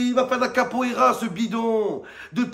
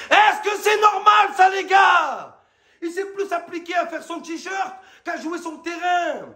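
outraged shout; 8 semitones higher than usual. Real, not acted. Outraged rant about Payet's shirt.

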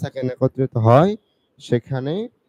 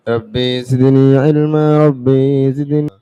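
loud, ringing Arabic supplication; 6 dB louder, much slower than usual. Acted. Teacher opening the lesson, loud fervent supplication. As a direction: call out the prayer with fervour.

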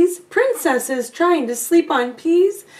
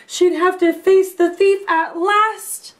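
dramatic build; 6 semitones higher, 4 dB louder than usual. A narrator's reading. Tense storytelling moment.

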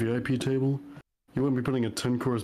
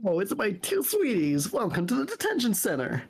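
gushing high voice; 8 semitones higher than usual. Acted. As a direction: gush over your sweeties. Voicing gushing character, high.

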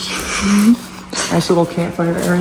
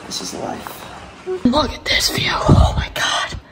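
awed breathy whisper, slow; 8 semitones higher, slower than usual. Real, not acted. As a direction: hush it out in awe. Awed at the view.

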